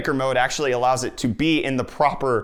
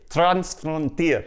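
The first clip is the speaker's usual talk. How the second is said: slow, higher Afrikaans-style pronunciation; attempted Afrikaans accent; 5 semitones higher, much slower than usual. Second try at the park name, attempted Afrikaans accent. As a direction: stretch it out in imitation Afrikaans. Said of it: "you gotta say it with the accent".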